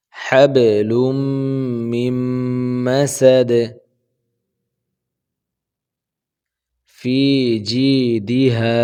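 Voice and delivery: slow melodic tajweed recitation; chanted Quranic recitation, slow and drawn out. Shown as a demonstration. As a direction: recite slowly with a long melodic line. Quran recitation of Surah Lahab.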